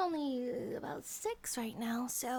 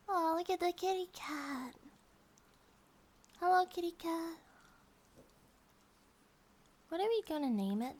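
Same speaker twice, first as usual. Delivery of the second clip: put-on higher, cooing baby talk; cooing at a kitten; 5 semitones higher than usual. Girlfriend cooing at a stray kitten in a higher voice. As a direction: coo at the little cat.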